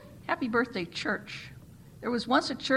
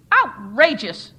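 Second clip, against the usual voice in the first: "Outrageous!" blasted much louder, slow and falling; screamed; 13 dB louder, slower than usual. A narrator's reading. The chairperson roars "Outrageous!" at the church, loud and slow. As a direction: bellow "Outrageous!" as a verdict. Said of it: "screamed the chairperson".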